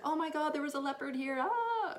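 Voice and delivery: high, rushed panicky retelling; hammed-up frightened babble; mock panic. Retells fleeing the tent in panic.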